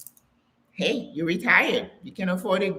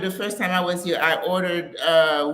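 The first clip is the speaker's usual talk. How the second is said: ordinary talk, nothing marked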